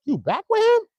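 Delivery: a hammed-up jealous yelp. Imitating [name] asking [name] if she's back with him.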